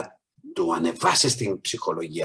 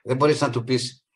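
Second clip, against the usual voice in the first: ordinary delivery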